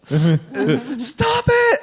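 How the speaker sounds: high, loud squeal of protest; mock teen yell. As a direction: imitate an exasperated girl shouting. Imitates annoyed teen.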